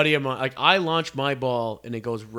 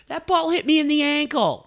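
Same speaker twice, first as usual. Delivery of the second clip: much higher, mocking squeaky lady voice; whiny old lady; 13 semitones higher, 5 dB louder than usual. Mocking the lady who claimed the ball hit her, high mock whine.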